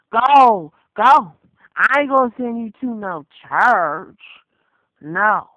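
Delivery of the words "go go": loud barked command; shouting. Real, not acted. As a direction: yell it to send them away. Shouted dismissals in rant.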